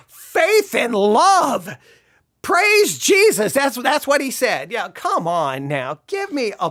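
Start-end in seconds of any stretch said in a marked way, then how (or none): 0.1-3.5 very slow, rising into a high pious gush; hammed-up holy exclamation, dragged out; mock pious Solomon. Solomon seeing breasts and mockingly praising, slow pious gush. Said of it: "he was like".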